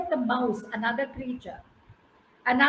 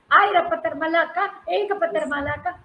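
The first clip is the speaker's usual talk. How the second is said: cry for help; 3 semitones higher, 4 dB louder, much faster than usual. Acted. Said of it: "somebody is shouting for help".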